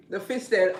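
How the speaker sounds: normal talking voice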